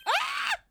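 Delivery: very high, loud cry; startled shriek. Acted. Girl shoved aside, loud high shriek. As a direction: shriek in surprise.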